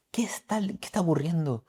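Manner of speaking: hushed, unvoiced question; whisper. Real, not acted. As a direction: lean in and whisper it. Pregunta susurrada. (pregunta en susurro)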